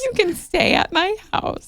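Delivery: high-pitched echo of a superfan; teasing, high imitation; mock overeager invitation. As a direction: parrot the gushing invite up high. Co-host echoes the overeager invitation.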